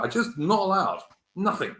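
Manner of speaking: ordinary delivery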